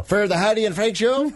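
high, hammed-up Irish lilt, rising at the end; jokey stage-Irish echo; mock Irish accent. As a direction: fake Irish brogue turned into a question. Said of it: "Our horrible Irish accent".

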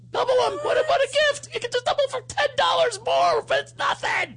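high over-the-top ad hype; excited pitchman. Acted. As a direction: sell it like a manic infomercial host. Hyped ad pitch voice.

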